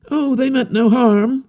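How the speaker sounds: put-on an eager young wanderer reassuring; harlequin youth. A narrator's reading. Harlequin assuring the captain about the natives, high and earnest.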